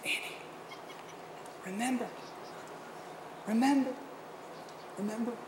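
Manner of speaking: very soft, hushed repeated remember; God's gentle reminder. Retold or imitated. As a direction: breathe it like a quiet inner voice. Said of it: "God in his grace and mercy said".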